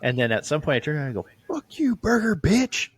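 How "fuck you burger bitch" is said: high, drawn-out mock yell; retold taunting yell. Drunk customer turns round to insult burger worker, mock yelling. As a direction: fling it back high and slow, replaying a parting insult.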